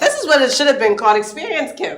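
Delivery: high, loud, fast playful tease; laughing playful tease; teasing laughter. Real, not acted. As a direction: laugh while renaming it.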